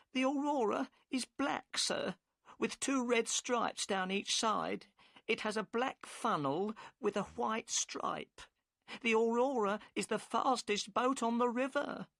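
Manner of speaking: the boatman's wife, worried and curt; upset wife. A narrator's reading. Narrator voices Mrs Smith.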